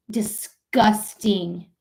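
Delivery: "Disgusting" drawn out very slowly. Real, not acted. Streamer condemning treatment of immigrants, slow emphatic disgust. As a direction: stretch each syllable of "Disgusting".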